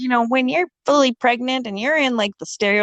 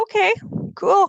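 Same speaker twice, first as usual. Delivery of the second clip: much higher mock-bright okay cool; high sarcastic okay cool; 11 semitones higher than usual. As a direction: fake cheer, dripping sarcasm. Sarcastic reply to nurse.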